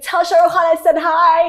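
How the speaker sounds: a touch of starstruck excitement; lightly voiced thrilled friend; excited friend. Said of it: "the other one's like".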